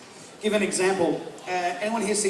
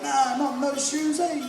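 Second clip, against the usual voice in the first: higher-pitched casual Kiwi doorman; New Zealand accent; 6 semitones higher than usual. Acted. Kiwi bouncer refuses entry at private function, high Kiwi accent. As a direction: turn the epic line into offhand Kiwi, pitched up. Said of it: "It's not the same, it's like".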